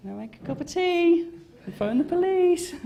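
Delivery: high put-on voice of a girlfriend calmly threatening to call the police; mock girlfriend, mildly alarmed; girlfriend's deadpan voice. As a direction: play the unfazed girlfriend, dry and high. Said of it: "she kind of walked in and went".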